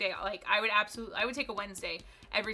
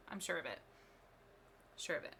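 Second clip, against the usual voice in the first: ordinary talk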